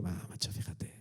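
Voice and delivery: whispered, lazy self-praise. Retold or imitated. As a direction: hush it like patting yourself on the back. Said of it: "tumbarme a la Bartola y decir". (susurro perezoso y complaciente)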